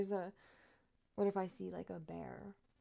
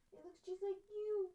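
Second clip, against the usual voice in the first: much higher, gushing sing-song; 11 semitones higher than usual. Real, not acted. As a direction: squeal it to the kitten. Gushing at cat.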